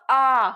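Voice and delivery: आ held as a long open "aa"; vowel sound demonstration. Shown as a demonstration. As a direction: isolate आ and draw the vowel out. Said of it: "it gives the sound of". (आ की लंबी ध्वनि दिखाएँ)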